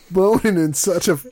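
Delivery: ordinary delivery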